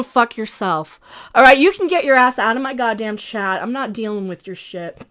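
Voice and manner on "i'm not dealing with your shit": usual speaking voice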